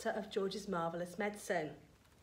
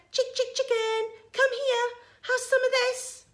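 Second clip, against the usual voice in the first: very high, loud hen call; coaxing call; 14 semitones higher, 7 dB louder than usual. A narrator's reading. George offering the hen the spoon, coaxing call. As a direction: coax the bird high and loud.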